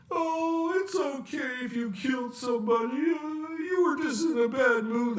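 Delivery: high parody of a lenient parent; permissive parent. Acted.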